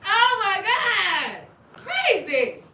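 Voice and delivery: high, loud, slow drawn-out amazement; laughing exclamation. Real, not acted. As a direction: stretch it out, cracking up.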